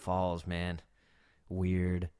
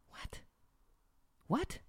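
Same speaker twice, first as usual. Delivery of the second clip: a high, incredulous "What?"; stunned disbelief; 9 dB quieter than usual. Real, not acted. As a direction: shoot way up in disbelief. Reacting to waitress refusing to leave.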